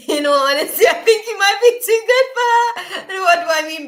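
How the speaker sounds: very high, loud words pushed through laughter; cracking up squeakily while speaking; laughing. Real, not acted. Telling a client they might be too good for her, laughing. Cracks up giving a blunt opinion.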